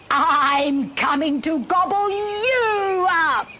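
high, slow storybook threat; bellowed threat. A narrator's reading. Threat to gobble up the enemy in a story, high drawn-out bellow. Goat and troll trade threats.

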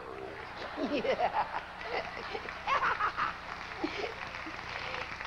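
long laugh at one's own joke; hearty chuckle. Acted. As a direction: laugh it out at length.

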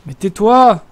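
loud playful shout. Real, not acted. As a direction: yell it at your friend. Shouting at friend's joke.

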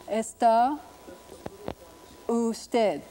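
Está, then usted, separated by a long pause; careful, separated Spanish Está usted; sounded-out Spanish. Shown as a demonstration. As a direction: dictate Está usted word by word, Está rising, usted falling. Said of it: "In I P A would be like this".

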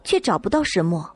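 ordinary delivery

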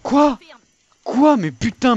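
high shocked yell; outraged shout. Real, not acted. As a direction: shout it in angry disbelief. Killed unfairly in game.

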